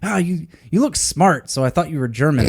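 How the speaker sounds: put-on old taxi driver. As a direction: play the chatty elderly cabbie. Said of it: "He's like".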